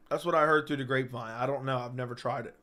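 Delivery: usual speaking voice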